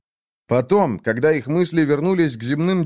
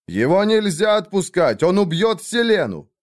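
urgent shout, pitched up; 7 semitones higher than usual. Acted. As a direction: yell a warning to stop him. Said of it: "Иосиас закричал". (крик)

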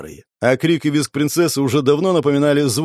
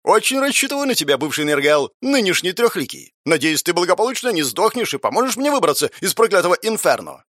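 put-on high, playful teenage boy; mischievous teen voice; 5 semitones higher than usual. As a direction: tease like a cheeky teenager. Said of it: "озорной голос подростка".

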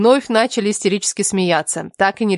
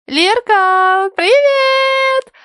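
very high, stretched-out squealed greeting; giddy, high-pitched tipsy shriek; excited squeal; 4 dB louder, much slower than usual. A narrator's reading. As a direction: squeal it down the phone, dragging out the vowels. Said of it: "В унисон завизжали мы".

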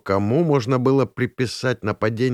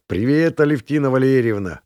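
raised, hearty greeting; exclaiming; 5 semitones higher, 4 dB louder than usual. A narrator's reading. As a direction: greet the newcomer in a higher voice. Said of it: "воскликнул Ромка". (громко приветствуя)

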